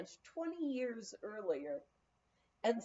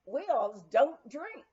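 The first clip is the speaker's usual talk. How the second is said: Texan drawl; 3 semitones higher, 6 dB louder, slower than usual. Retold or imitated. Spokesman explains.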